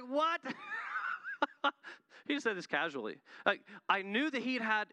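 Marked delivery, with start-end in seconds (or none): 0.0-0.4 retold startled yelp, much higher and louder; stunned laughing outcry; shocked what. Shocked reaction to pastor's confession. 0.5-1.9 high squealing laugh of shock. Retold or imitated. Reacting to gambling confession.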